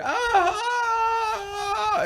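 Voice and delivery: hammed-up wail of helpless suffering; mock pain wail. Imitates enduring the pain.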